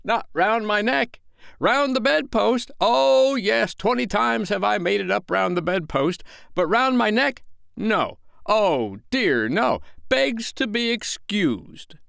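high, loud, biting sarcasm; mock the tie with furious sarcasm; exasperated husband ranting in scornful exaggeration; dangerously sarcastic. A narrator's reading. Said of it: "He became dangerously sarcastic".

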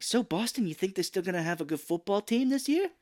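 put-on high, sing-along small talk to a child; mock kid-friendly chat. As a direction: imitate clumsy talk to a kid. Mark chatting up a little girl.

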